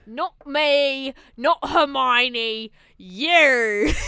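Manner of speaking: loud, drawn-out mock-dramatic line; hammed-up movie quote. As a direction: punch out each phrase like a parody. Acting out a famous movie quote.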